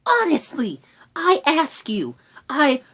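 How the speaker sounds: high, affronted rat sputter; indignant little creature, cut off. A narrator's reading. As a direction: protest in a small high voice. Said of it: "gasped indignantly".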